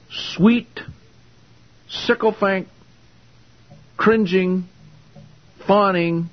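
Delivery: high, cooing mock-syrupy words; mock sweet. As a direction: drip with fake sweetness, mockingly. Mocking a servile love.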